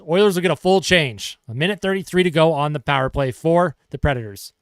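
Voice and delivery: brisk hockey play-by-play call; sportscaster calling the action. Acted. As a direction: call the penalty kill live. Live play-by-play of penalty kill.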